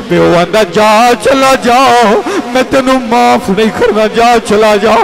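high, loud impassioned sermon; full-force preaching. Acted. Preacher building to the climax of the story, full-force preaching.

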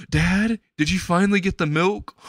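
lightly voiced boy calling to his dad; eager son. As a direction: ask Dad with a hopeful lift. Son spots long-absent dad.

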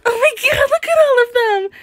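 high, loud, fast thrilled cry; excited squeal. Real, not acted. Gamer spotting the whole school, high excited cry. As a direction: gasp in delight at the crowd. Sees crowd of students.

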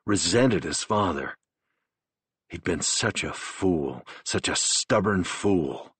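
usual speaking voice

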